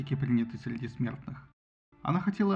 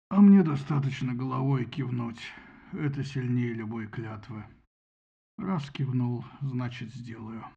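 низкий властный бас; 3 semitones lower, slower than usual. A narrator's reading. Зевс властно объясняет.

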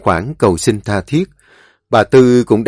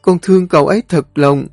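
tearful pleading; 9 semitones higher than usual. A narrator's reading. Mai pleading with her mother through tears, high-pitched. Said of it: "Tuyết Mai bây giờ mới khóc theo rồi nói trong nghẹn ngào".

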